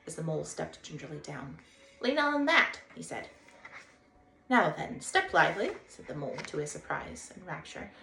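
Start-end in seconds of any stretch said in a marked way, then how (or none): 2.0-2.8 bright, high and loud Water Rat; cheerful Rat calling a greeting; a lively riverbank rat hailing a stranger; Rat's voice. Acted. Rat helps Mole down. 4.5-5.8 bright, high and loud Water Rat; cheerful Rat calling a greeting; a lively riverbank rat hailing a stranger; Rat's voice. Acted. Rat hurries Mole.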